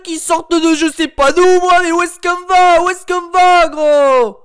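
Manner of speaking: high, loud ranting impression; Matt se fait des films impression. Imitating a YouTuber ranting about Marseille, loud high rant. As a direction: rant high and loud like a fed-up football fan. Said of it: "Je peux également imiter Matt se fait des films".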